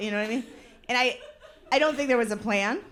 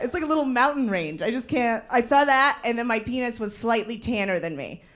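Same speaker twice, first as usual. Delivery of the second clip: ordinary delivery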